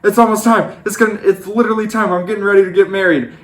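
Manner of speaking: high, fast panicked babble; mock panic before the wedding, high and rushed. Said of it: "losing it again".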